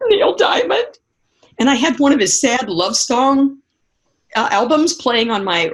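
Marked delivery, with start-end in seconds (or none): 0.1-1.0 high-pitched mock-crying whimper; imitated tearful answer. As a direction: squeak it out like a sobbing teen. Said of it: "I must have been sobbing".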